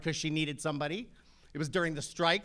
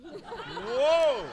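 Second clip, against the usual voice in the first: laughing "Whoa, whoa", the second one jumping higher and louder; a retold gasp of wonder, chuckling through it; amazed whoa; 5 semitones higher, 5 dB louder than usual. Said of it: "I'm like".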